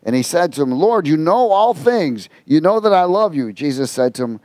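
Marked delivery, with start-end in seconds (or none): 0.7-3.5 a hurt plea of love; grieved Peter. Acted. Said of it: "Peter was grieved".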